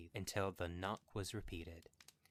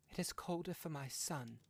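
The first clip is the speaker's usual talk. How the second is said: grieving; 8 semitones higher than usual. Acted. Grieving mother answers husband.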